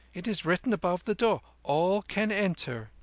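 high-pitched confident youngster; boy Kim. Acted. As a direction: answer briskly in a high boy's voice. Narrator voices Kim answering.

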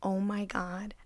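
soft, whispery dawning dread; hushed realization. Retold or imitated. She realizes she is in his apartment, hushed. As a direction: let it sink in under your breath. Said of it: "it hit me".

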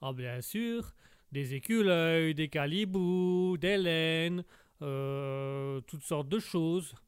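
slow, drawn-out Québécois list; Québécois accent. Acted. Québécois lumberjack lists forest animals, slow Québécois accent. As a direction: stretch each item in a Québécois accent. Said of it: "quand on est bûcheron".

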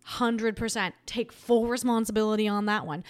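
ordinary delivery

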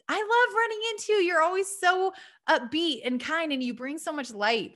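gushing compliment. Acted. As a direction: gush warmly to a friend you just ran into. Models telling someone a compliment.

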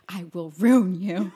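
slow, loud mock threat; retold wrath. Recalling her fury at a guy, wrathful vow. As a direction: threaten with drawn-out, emphatic menace. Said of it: "The wrath of".

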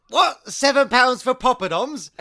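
high, loud incredulous question; acted outraged disbelief. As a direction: imitate a customer appalled by the bill. Retold customer outraged at bill.

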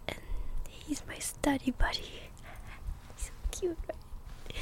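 gentle whispered storytelling; soft-spoken ASMR. Real, not acted. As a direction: whisper softly, like a bedtime chat.

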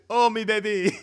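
high, loud French-style wail; hammed-up French grief for a lover; mock French lament. Joking fake French mourning.

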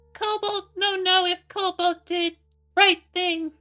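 very high, broken-English little creature; Cobalt's creature voice. Acted. Cobalt writing to his master, high creature voice. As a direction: squeak it out like a small dragon-kin.

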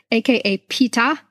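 ordinary delivery

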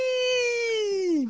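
long high mock squeal Ee. Pitchers squealing at each other on Instagram Live, high squeal. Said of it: "looking at each other and going".

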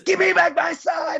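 high, slow frantic parent; hammed-up panicked parent; angry parent. Voicing the parent.

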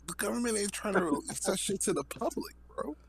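excited rant. Real, not acted. Excited rant about the government.

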